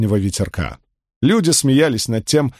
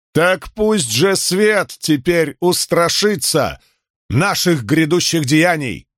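high, slow ruler's vow; proclaiming khan; 5 semitones higher, slower than usual. Acted. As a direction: declare it to thirty thousand. New khan's oath to the crowd.

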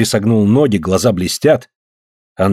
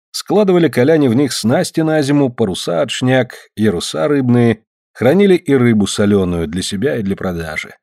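ordinary delivery, nothing marked

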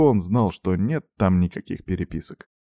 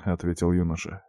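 plain reading voice

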